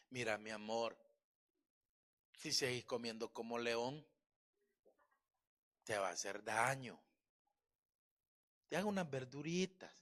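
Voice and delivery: a hint of a soft, tender husband; gentle loving tone. Husband gently advising his wife, soft and tender. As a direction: speak to her gently, mildly soft. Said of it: "se oyó bonito".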